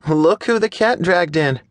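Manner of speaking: put-on high teasing girl's voice, smirking; smirking tease. A narrator's reading. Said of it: "she smirks".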